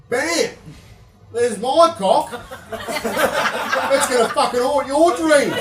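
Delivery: loud, high gleeful yell through laughter; triumphant shout. Pretend. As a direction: shout the reveal like a triumphant gotcha. Triumphant doctor reveal punchline.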